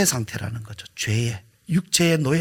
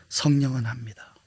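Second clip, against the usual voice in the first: much softer, whispered answer; 3 semitones lower, 5 dB quieter than usual. Real, not acted.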